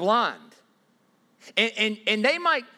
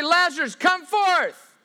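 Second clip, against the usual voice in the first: "Lazarus, come forth" yelled much higher and louder; mock shouted call; 9 semitones higher, 5 dB louder, slower than usual. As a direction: ham up a straining summons. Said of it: "God doesn't say".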